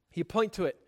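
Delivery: normal talking voice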